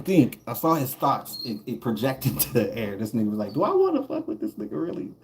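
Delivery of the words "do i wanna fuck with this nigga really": high, fast squeaky mock of the dude's thought; mock inner voice. The dude at the bus stop wondering about a fight, squeaky and fast. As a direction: toss it off high and quick like a nervous guy sizing up trouble.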